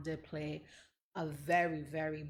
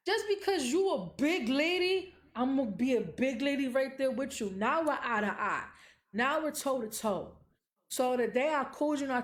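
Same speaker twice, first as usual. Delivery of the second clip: raised, high, forceful telling; squaring up to her mother out loud; heated defiance; 7 semitones higher, 5 dB louder than usual. Real, not acted. Telling the host how she stood up to her mother, loud and high.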